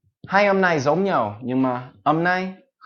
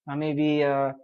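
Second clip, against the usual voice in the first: ordinary delivery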